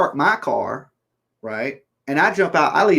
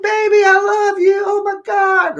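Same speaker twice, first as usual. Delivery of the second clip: put-on much higher, squealy sweet girlfriend; 4 dB louder than usual. Girlfriend suddenly sweet to her hurt boyfriend, high squeal. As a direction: gush it in a high girly voice. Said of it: "she's like".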